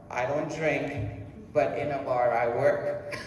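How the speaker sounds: ordinary talk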